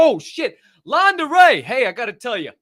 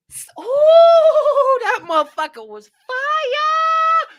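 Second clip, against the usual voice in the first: much higher and louder, a slow stretched "ooh" into a hype yell; hyped shout; 11 dB louder, much slower than usual. Real, not acted. Praising yesterday's winner's purple setup with a hyped shout. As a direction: yell it like the best thing you've seen all week. Hyping a viewer's win.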